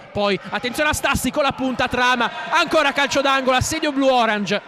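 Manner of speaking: rising, urgent play-by-play; excited commentary. Real, not acted. Commentator heats up on corner.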